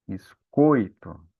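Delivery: Biscoito very slow, the o of coi leaning strong; slow syllable demo. Shown as a demonstration. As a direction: spell out Biscoito slowly by sound. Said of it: "o som mais forte ali é do o".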